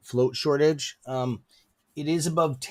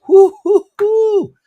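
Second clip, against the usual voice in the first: excited whoop; 13 dB louder, slower than usual. Real, not acted. Cheering insiders buying heavily.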